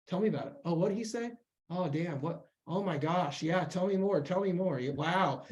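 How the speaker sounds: gently reacting to shocking news; sympathetic listener. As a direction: play the friend drawing out the story. Acts out friend listening to confidant.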